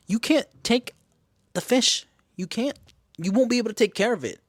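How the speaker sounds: high-pitched emphatic mock scolding; arguing mom. Mom telling daughter she can't keep the fish, scolding. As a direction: imitate a mom insisting, word by word. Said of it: "during the argument, the mom is like".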